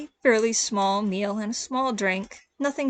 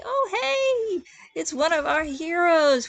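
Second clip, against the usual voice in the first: high, drawn-out cheery greeting; cheerful waitress; 7 semitones higher, 3 dB louder, slower than usual. Acted. Fox waitress greets the tavern's heroes, cheerfully. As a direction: greet them in a high, chirpy voice. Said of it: "says rather cheerfully".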